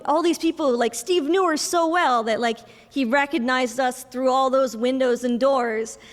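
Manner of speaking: ordinary delivery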